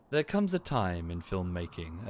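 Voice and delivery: lightly imitated authoritative critic; Mauler's authoritative tone. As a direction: mimic a solemn essayist over sad music. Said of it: "authoritatively talking about something".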